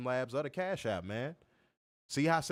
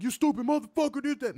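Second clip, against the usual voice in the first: high-pitched mock outburst; mock rah-rah rant; 11 semitones higher, 4 dB louder than usual. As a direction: act out an over-excited angry tirade. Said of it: "Don't expect rah-rah, though. Don't expect me to be like".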